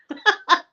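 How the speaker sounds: short high, loud laugh. Real, not acted. Joking with viewers about not doing it again, laughing. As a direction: burst out laughing.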